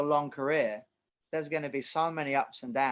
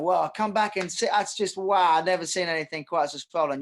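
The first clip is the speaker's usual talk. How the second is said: amazed doctor; 5 semitones higher, 6 dB louder than usual. Retold or imitated. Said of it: "And the doctor going".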